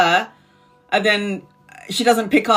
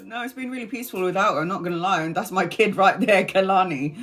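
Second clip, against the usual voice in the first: normal talking voice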